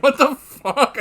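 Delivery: shocked reaction. Retold or imitated. Recalling his own reaction in the cinema, loud shocked cry. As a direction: blurt it out in stunned disbelief. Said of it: "I was just like".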